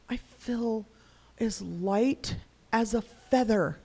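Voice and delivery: put-on slow, airy child voice climbing high; drawn-out wonder, floating upward; imitating relieved child. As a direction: voice a little boy light with relief. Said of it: "he's so relieved afterwards".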